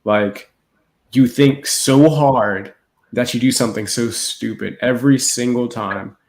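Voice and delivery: usual speaking voice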